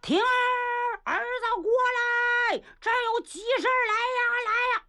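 big-voiced call. A narrator's reading. Niu Gao summons his son over urgently, big-voiced hollering. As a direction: call out from afar in a high, stretched shout. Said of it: "牛皋嗓门大呀".

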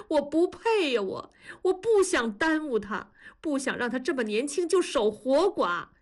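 high-pitched, despairing self-reproach; anguished pleading. A narrator's reading. Husband begs in-laws to let him go, anguished. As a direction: plead desperately, voice pushed high.

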